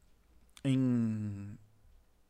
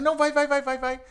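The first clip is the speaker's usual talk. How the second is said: high, louder chant of go go go; mock egging on; 8 semitones higher, 4 dB louder than usual. Friends pushing him onto the stage, high excited urging. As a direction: push him to go up, pestering.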